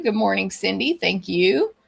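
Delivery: normal talking voice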